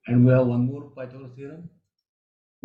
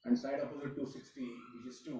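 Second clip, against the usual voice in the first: very faint and far from the mic; almost inaudible, trailing into nothing; barely audible; 17 dB quieter than usual. Real, not acted. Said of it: "Sir, your voice is very low".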